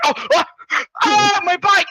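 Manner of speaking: high, mock-distressed wail; hammed-up shock at losing the bike; panicked outcry. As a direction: cry out in exaggerated dismay. Retelling a character's panic.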